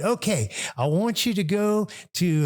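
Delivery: a hint of the eager host impression; Hugh. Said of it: "they called and said".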